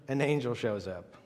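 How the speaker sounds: usual speaking voice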